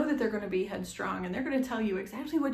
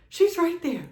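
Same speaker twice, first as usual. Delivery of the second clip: excited squeal; 9 semitones higher, 8 dB louder than usual. Retold or imitated. Excited birth moment, high squeal.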